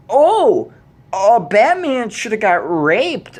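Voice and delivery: high, loud, slowed exclamation; loud high mocking disbelief; incredulous outrage. Real, not acted. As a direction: spit back the idea you can't believe. Repeating the idea in outrage.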